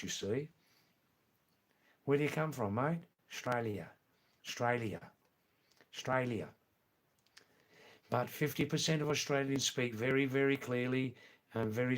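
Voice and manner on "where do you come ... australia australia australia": clipped broad Australian, first syllable swallowed; Strine Australian accent. Shown as a demonstration. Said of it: "They don't speak like that at all".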